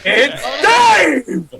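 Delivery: high, drawn-out announcing cry; mock excited cheer. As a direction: hail him like a star arriving. Acting out excited crowd reaction.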